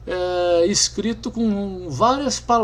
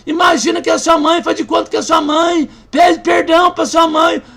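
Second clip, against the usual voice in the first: shouted urging; 8 semitones higher, 7 dB louder than usual. Retold or imitated. Pastor shouting urging forgiveness.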